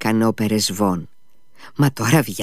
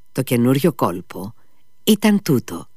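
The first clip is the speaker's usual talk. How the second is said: ordinary delivery, nothing marked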